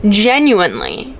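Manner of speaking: ordinary talk, nothing marked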